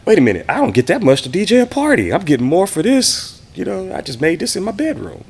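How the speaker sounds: excited. Retold or imitated. Retelling his amazed reaction to pay.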